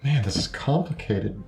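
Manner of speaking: ordinary delivery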